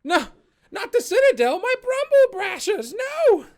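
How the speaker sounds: very high, loud squeaky wail; a little creature crying out in despair; Warball's dismay. Acted. Warball protesting about the Citadel, very high, loud and wailing. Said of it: "you hear Warball come over and say".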